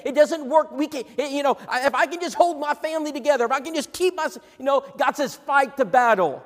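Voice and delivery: high, loud rising exhortation; rally the flock, pushing harder each line; fiery sermon climb; preaching at full force. Acted.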